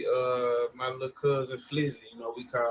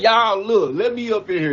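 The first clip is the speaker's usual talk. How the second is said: high, loud laughing plea; playful friend impression; 8 semitones higher, 8 dB louder than usual. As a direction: beg to be let in, laughing. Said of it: "he was like".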